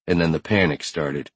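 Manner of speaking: usual speaking voice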